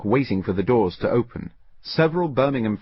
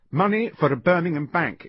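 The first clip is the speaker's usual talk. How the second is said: urgent explaining; 9 semitones higher than usual. A narrator's reading. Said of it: "explained Hazel".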